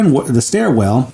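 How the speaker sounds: ordinary delivery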